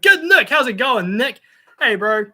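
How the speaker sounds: loud, high, hearty hail; called-out greeting. Real, not acted. Streamer greeting a viewer in the chat, calling out loudly. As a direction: holler hello to a mate.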